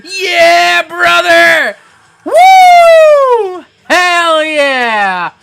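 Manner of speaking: hyped shouting. Pretend. Streamer thanking donor Rocked Net, whooping hype. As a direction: pump up the crowd like a hype man.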